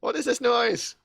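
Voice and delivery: lightly voiced irate official; arbitrator impression. Fake arbitrator questioning a hapless guard, mildly indignant impression. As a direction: demand it like an exasperated superior, starting high. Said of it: "do a good enough impression".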